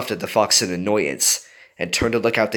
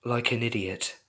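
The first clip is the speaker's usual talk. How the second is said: gloomy; 3 semitones higher, 7 dB quieter than usual. A narrator's reading. Jinx in despair.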